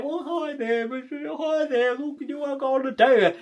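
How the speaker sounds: high, meek little voice; squeaky, unsure mock attempt at flirting; timid asking-out.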